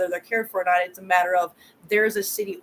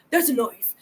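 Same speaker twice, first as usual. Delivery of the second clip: higher-pitched mock Aussie "noife", amused; Australian accent; 6 semitones higher than usual. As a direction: do a playful Crocodile Dundee Australian accent. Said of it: "that's my best Australian accent".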